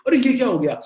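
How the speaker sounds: mock dismay, voice jumping up; alarmed reaction. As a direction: play a controller suddenly alarmed by the figures. Controller's shocked reaction at wrong figure.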